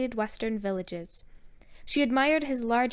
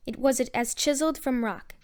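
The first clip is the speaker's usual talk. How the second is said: ordinary delivery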